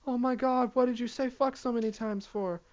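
high, quiet mock-shocked voice; high scandalized mock; mocking people. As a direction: gasp it out like a scandalized stranger. Mocking what people would say.

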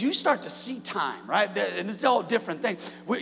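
ordinary delivery

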